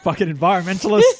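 laughing mock outrage. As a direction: curse them while cracking up. Mock curse amid laughter.

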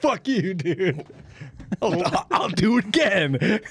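hammed-up high taunt; mock defiant player. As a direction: taunt the ref gleefully.

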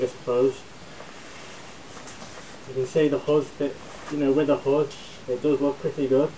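muffled behind mask filter; speaking inside respirator; through a gas mask. Real, not acted. Seen 1: Full-face gas mask on throughout; briefly turns away, still wearing.

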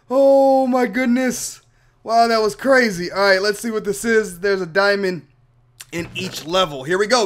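ordinary talk, nothing marked

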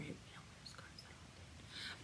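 whispering; whispered aside. Real, not acted. Aside to listeners.

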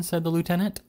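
ordinary delivery, nothing marked

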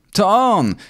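put-on high childish cry of accusation; accusing shout. A narrator's reading. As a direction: shout it like a tattling boy. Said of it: "krzyknął Mamert".

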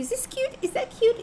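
high-pitched imitation of girls asking for opinions; girl out shopping. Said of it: "they're gonna drag you down to shopping".

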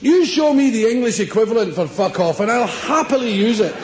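ordinary talk, nothing marked

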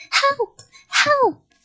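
very high, loud cries for help; yelled. A narrator's reading. Child pounding on the locked door, panicked yelling. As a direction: yell in panic. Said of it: "pounded on the door and yelled".

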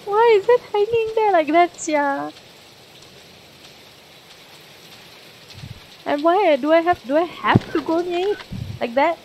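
high, loud frightened squeal; panicky, pitch shooting up; scared. Real, not acted. Streamer freaking out at a hanging body, scared and loud. Spooked by hanging figure in game.

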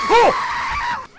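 urgent panicked chant; being chased. Real, not acted. Fleeing monster.

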